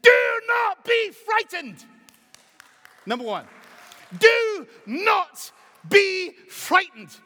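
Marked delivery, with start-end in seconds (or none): 0.0-1.9 booming slow preacher cry; full-force preaching. Acted. Preacher to congregation, booming slow full-force cry. 4.2-7.1 slow, drawn-out booming command; preaching shout. Acted. Preacher commanding the congregation, slow booming shout.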